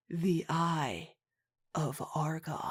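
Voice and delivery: hushed mock-epic reveal; dramatic hush. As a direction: drop to a hush for the name.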